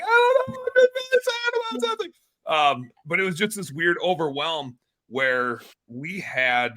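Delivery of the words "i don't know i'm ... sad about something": very high, wobbly crying voice; mock sobbing. Retelling himself crying in the car, high mock sob. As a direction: squeak it out like a crying man. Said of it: "And I'm just like".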